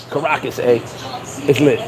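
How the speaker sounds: low mock bravado; mock hyped tourist. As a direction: imitate the dudes bragging about the trip. Mocking hyped travelers.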